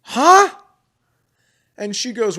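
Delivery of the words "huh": sharp, much higher, loud huh; a jolt of total disbelief; retold stunned yelp; shocked huh. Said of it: "And I go".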